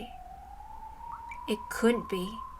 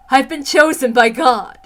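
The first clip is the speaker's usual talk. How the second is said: zealous declaration; 2 semitones higher, 9 dB louder than usual. Acted. Zealous villain declares herself.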